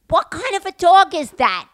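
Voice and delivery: high, loud question; retold calling out. Friend asking the screaming man about his dog, high loud call. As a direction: call it across the road. Friend calls out mid-quarrel.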